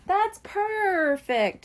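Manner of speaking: high, slow delighted kitten; delighted exclaim. A narrator's reading. Coral thanking the grown-ups, slow and delighted. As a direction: savor the good news. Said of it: "she exclaimed".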